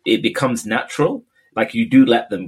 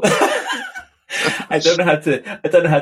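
higher, words tumbling out through real laughter; laughing reply; 8 semitones higher, 3 dB louder, slower than usual. Real, not acted. Friend replying to a boast about gliding through water, laughing. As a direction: try to answer while cracking up.

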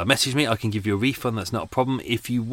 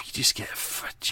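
whispered, slow, after a sigh; resigned whisper; 7 dB quieter, slower than usual. Real, not acted. Host whispering to listeners about weight gain with age. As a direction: breathe it out like a weary confession. Sighing aside about getting older.

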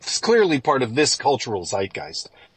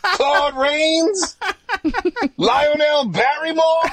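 much higher, hammed-up scolding voice; Mother Nature; 3 dB louder than usual. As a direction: tear into them in an odd put-on accent. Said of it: "I don't know what that accent is".

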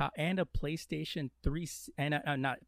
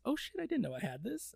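mock surprise; 9 semitones higher than usual. Acting giveaway host.

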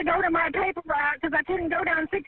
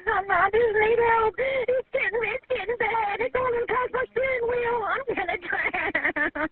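high, slow panic breaking into sobs; real wailing plea, collapsing into tears; terrified pleading; 3 semitones higher, slower than usual. Real, not acted. Driver begs as water passes steering wheel, terrified pleading.

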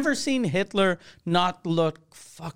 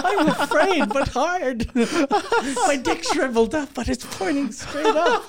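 high-pitched, cracking up through it; laughing confession; 10 semitones higher than usual. Retold or imitated. Listener reacting to German yelling, laughing mock-fearful squeal.

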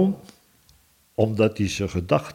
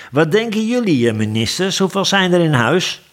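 high, raised royal question; calling on ministers; 9 semitones higher, 4 dB louder than usual. A narrator's reading. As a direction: throw the question at them suddenly. King suddenly turns on dozing ministers.

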